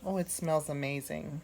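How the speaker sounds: usual speaking voice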